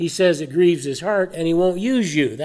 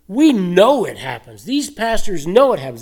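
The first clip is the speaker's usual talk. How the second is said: preacher pounding the point; emphatic insistence; 4 semitones higher, 6 dB louder than usual. Real, not acted. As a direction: hammer home that they know. Preacher insisting pastors know it.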